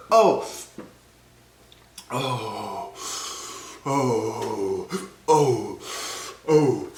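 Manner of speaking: puffed groans through the burn; pained gasping "oh". Real, not acted. Chip eater struggling to breathe, gasping moans. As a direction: blow out hard on each moan, fighting for air. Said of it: "I don't know how to breathe".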